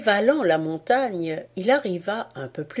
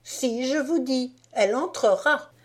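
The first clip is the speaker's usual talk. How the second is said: higher put-on arguing voice; second voice in the skit; 6 semitones higher than usual. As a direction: play the other side of the quarrel, higher. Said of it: "interpréta à haute voix une scénette énergique".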